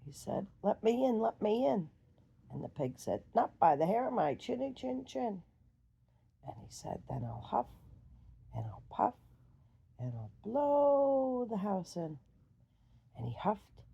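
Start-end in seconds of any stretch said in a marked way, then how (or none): 0.6-1.9 higher, loud insistent call; demanding wolf. Acted. Wolf at brick house. 3.3-5.4 higher, louder pig voice; defiant little pig. Acted. Pig refuses wolf. 7.1-12.2 threatening wolf. Acted.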